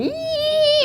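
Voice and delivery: high shrill drill squeal, held steady; drill whine. Retold or imitated. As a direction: imitate a dentist drill whine. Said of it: "it's going".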